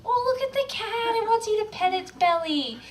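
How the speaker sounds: high cooing voice; cooing at a cat. Retold or imitated. Person cooing at a cat showing its belly. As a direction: coo like you're fooled by the cat. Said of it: "and you'd like".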